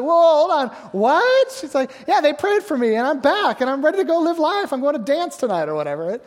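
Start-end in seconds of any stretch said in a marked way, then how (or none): 0.0-1.5 sharp high whoa, the what jumping way up; stunned daughter. Retold or imitated. Daughter seeing her dying mother up and dressed, stunned. 1.5-5.7 a higher, eager, lively voice for the healed mother; excited mother. Retold or imitated. Healed mother telling her daughter she is leaving, excited.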